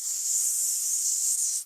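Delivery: unvoiced hiss; slow steady hiss out; long hissing s. Shown as a demonstration. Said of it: "like this".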